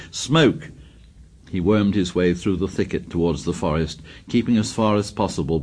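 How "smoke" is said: understated narrated shout, pitched higher; a contained call, raised in pitch. A narrator's reading. Savage announcing smoke at the thicket, restrained shout. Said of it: "a voice shouted".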